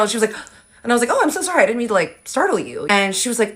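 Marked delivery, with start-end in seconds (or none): 1.1-2.9 sugary apology. Retold or imitated. Apologizing to startled girl.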